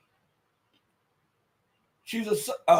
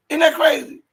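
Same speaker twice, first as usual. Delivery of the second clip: incredulous; 8 semitones higher, 6 dB louder than usual. Real, not acted. Incredulous aside to viewers.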